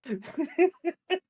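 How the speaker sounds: high, helpless real giggles; hard laughing. Real, not acted. Laughing on a call for no clear reason, helpless giggling. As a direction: keep cracking up, unable to stop. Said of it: "laughing so hard".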